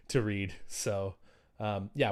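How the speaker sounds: ordinary talk, nothing marked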